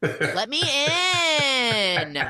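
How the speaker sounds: high, slow, hammed-up wail; mock wailing plea. Daughter wailing at her mother's locked door, hammed-up. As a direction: stretch it out like a pitiful sob.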